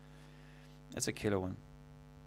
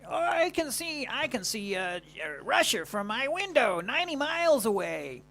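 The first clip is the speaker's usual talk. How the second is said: much higher old crone voice, mocking a politician; mocking Sarah Palin; 12 semitones higher, 5 dB louder than usual. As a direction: squeak it out like the witch in the forest. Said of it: "Sorry about my accent".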